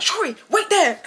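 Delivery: high acted call to wait; urgent order. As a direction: call out a hurried order to a sister. Orders sister to wait mid-chase.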